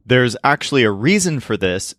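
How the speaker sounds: usual speaking voice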